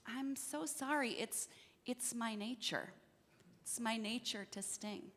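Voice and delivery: quiet, regretful confession; soft apology. Acted. Scorpion apologises to the frog as both sink, soft and regretful. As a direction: apologise softly, letting the pitch sink at the end.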